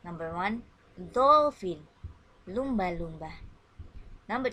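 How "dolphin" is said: dolphin called out loud for the class; teacher shouting a vocabulary word out; loud model word. Shown as a demonstration. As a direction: call out dolphin loudly for children to echo. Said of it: "listen and repeat after me".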